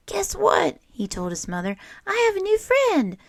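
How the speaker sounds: put-on excited child. Franklin tells his mother about his new friend, excited and high. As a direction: burst in with happy news.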